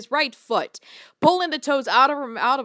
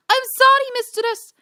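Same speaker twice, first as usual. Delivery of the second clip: much higher, loud desperate cry; desperate yell; 12 semitones higher, 4 dB louder than usual. Acted. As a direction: plead desperately. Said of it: "Luna desperately yelled".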